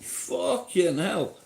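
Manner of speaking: usual speaking voice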